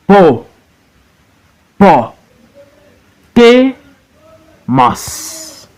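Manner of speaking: each syllable of "hip, po, po, te, mas" alone with a long pause; high "hip" and "te", falling "mas", slowly sounded out; broken into syllables. Shown as a demonstration. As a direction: teach "hip, po, po, te, mas" one isolated beat at a time. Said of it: "You break into syllables".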